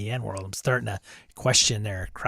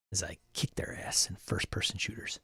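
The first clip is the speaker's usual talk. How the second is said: whispered aside; 9 dB quieter than usual. Real, not acted. Smug aside after the quote.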